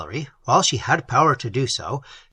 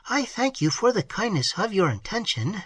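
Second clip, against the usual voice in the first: higher, softer polite lady; higher softer woman's voice; 6 semitones higher, 3 dB quieter than usual. A narrator's reading. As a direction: answer high and softly. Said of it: "she replied".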